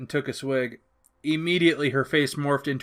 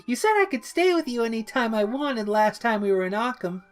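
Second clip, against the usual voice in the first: high, sheepish rambling; high bashful Harley; shy Harley; 8 semitones higher than usual. A narrator's reading. Harley shyly explains.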